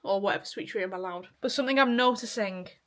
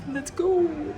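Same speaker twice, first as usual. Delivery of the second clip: high-pitched, drawn-out cheer; gym hype call; 7 semitones higher, 3 dB louder than usual. Real, not acted. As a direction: call it out high to psych yourself up. Hyping up during the gym workout.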